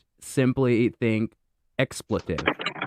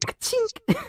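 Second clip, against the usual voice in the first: cymbal rim shot sound; 4 dB quieter than usual. Shown as a demonstration.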